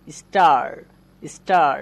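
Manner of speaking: স্টার loud with a long drawn aa; long aa in star. Shown as a demonstration. Teacher demonstrating a long vowel loudly. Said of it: "সময় বেশি লাগছে বলে এগুলো long sound".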